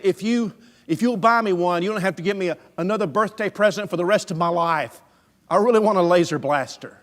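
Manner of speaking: put-on loud, high bargaining like a desperate boy; imitating a kid's urgent plea; pleading child. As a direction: beg hard, pushing every word. Boy bargains and begs father.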